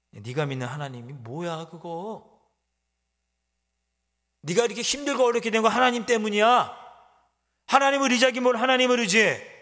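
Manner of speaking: enemy ridiculing God; scornful mockery. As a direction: sneer at their faith. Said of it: "라고 조롱합니다".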